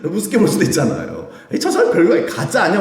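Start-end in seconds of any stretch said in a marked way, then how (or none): 1.5-2.8 mocking scoff. Mocking onlookers sneer at Paul.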